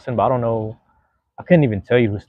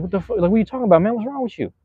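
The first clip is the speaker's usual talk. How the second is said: imitating angry player; 12 semitones higher, faster than usual. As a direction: fire back like an insulted star. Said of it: "he turns around, he's like".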